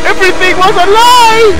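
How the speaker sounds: high, hammed-up wail; anguished wail. Player betrayed after Amy's death, high anguished wail. Repeats outburst higher.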